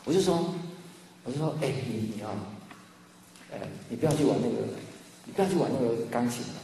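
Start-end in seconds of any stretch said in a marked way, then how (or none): 1.6-6.6 put-on soft and quick, baiting the child to disobey; hushed and hurried reverse-psychology warning; whispering a forbidden thing to tempt the nephew; sly hushed warning. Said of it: "我用了伊甸园的这个方法".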